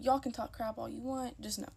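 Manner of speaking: ordinary talk, nothing marked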